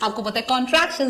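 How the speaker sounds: ordinary talk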